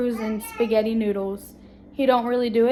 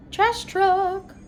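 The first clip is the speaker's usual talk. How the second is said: greeting the truck; 8 semitones higher than usual. Real, not acted. Mom pointing out the trash truck to her little boy, high and playful.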